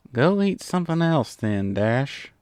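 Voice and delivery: ordinary delivery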